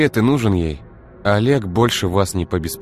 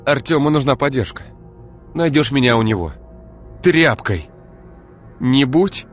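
stern parting order; 9 semitones higher, 4 dB louder than usual. Acted. Ira parting order, angry.

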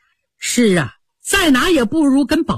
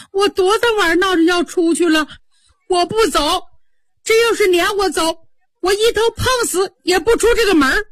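high, rising desperate protest, faster at the end; 9 semitones higher than usual. Acted. Maid defying her young master, high and desperate. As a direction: cry out that she will die before leaving. Qingwen refuses to be sent away.

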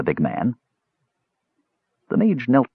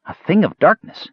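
awed delight; 9 semitones higher than usual. A narrator's reading. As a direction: say it like the best news ever. Kender echoes with delight.

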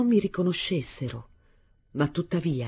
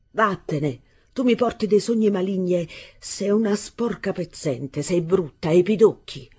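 angry insults; 4 semitones higher, 4 dB louder than usual. Acted. Arturo insults his stepmother.

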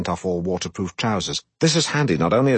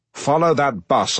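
higher, slow commanding bellow; 6 semitones higher than usual. Acted. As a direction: bark the order at the driver. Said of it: "I bellow".

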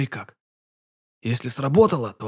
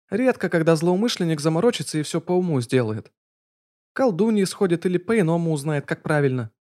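put-on higher, knowing young female voice; a young spirit explaining, pitched up; high fox spirit girl; 6 semitones higher than usual. A narrator's reading. Ответила Кицуна. (высокий девичий голос Кицуны)

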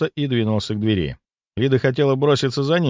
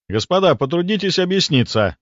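indignant demand; 8 semitones higher than usual. Acted. Narrator confronts the hunters.

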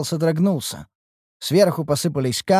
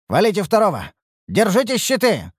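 shouting orders; 8 semitones higher than usual. Acted. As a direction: bark the orders over the fight. Commander shouts orders in battle.

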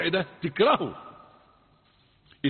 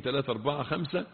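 ordinary delivery

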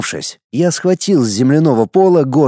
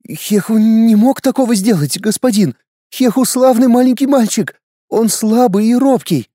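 pleading mother; 9 semitones higher than usual. Acted. Mother pleads for her son.

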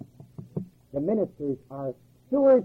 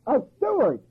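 ordinary delivery

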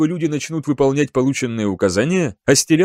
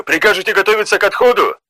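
sergeant asking; 5 semitones higher, faster than usual. A narrator's reading. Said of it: "спросил по коммуникатору сержант Жак".

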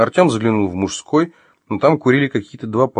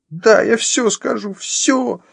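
very high, drawn-out panicked yelp; frightened squeal; much slower than usual. Acted. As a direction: squeal your surrender in terror. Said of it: "взвизгнула она и от страха".

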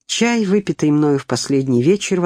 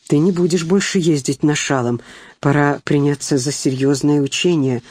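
ordinary delivery

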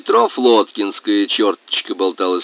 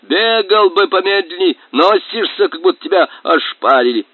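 shouting; 9 semitones higher, 4 dB louder than usual. Acted. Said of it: "закричал он в сторону колодца".

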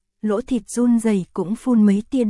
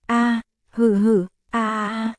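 drawn-out moans. Acted. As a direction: let the moans stretch out and die away. Character moans during love scene.

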